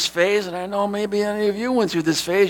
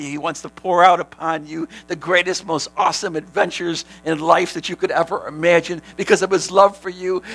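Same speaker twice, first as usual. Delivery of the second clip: ordinary talk, nothing marked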